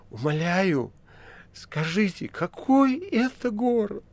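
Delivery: high, slow begging voice; desperate pleading. A narrator's reading. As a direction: beg in desperate confusion. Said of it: "Стёпа... произнёс". (отчаянная мольба)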